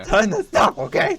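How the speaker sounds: imitating the yell. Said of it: "And then he's like".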